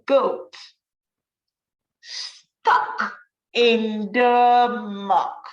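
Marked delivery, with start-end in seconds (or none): 2.0-5.4 slow drawn out. Acted. Dramatic story ending.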